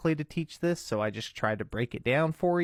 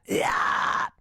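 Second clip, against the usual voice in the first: breathy scream "yeah"; 3 dB louder than usual. Shown as a demonstration. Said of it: "push from your diaphragm into that".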